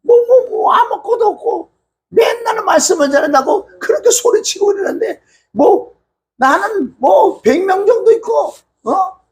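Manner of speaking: high, sneering boastful jeer; mocking rival voice. 교인 많은 목사가 개척교회 목사를 비웃는, 높고 깔보는 목소리. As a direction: play the proud rival looking down on the poor pastor. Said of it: "조금 전에 제가 얘기한 거".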